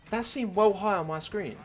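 high, loud, disbelieving protest; incredulous protest. Real, not acted. Player baffled by what the screen showed, incredulous protest. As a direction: pitch it up, loudly doubting what was seen. Still incredulous about the jump.

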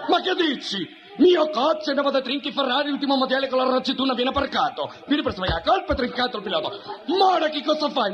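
high-pitched, worked-up Italian tirade; mock Italian accent. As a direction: protest frantically in a comic Italian accent. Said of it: "Se me queda así el italiano".

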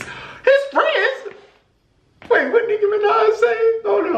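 high, disbelieving squeak; stunned echo of the words; shocked. Real, not acted. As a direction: react like you can't believe it. Shocked reaction to a message.

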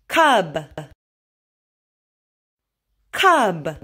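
crisp, aspirated K at word start; over enunciating. Shown as a demonstration. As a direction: model each word slowly and clearly for a child. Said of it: "Just repeat after me".

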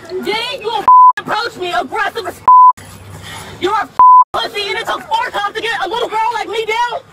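high, loud angry rant; furious yelling. Real, not acted. Driver berates arresting officers.

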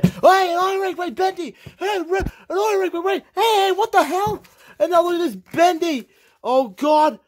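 very high, racing hype call; frantic commentary. Acted. Commentator calling Bendy's sneaky win, high and frantic. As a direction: scream the finish to the count. Final sequence, commentator screaming the finish.

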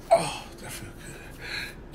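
whispered, slow and blissful; soft pleased whisper; contented whisper. Acted. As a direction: breathe it out like a dog being scratched. Dog enjoying a scratch.

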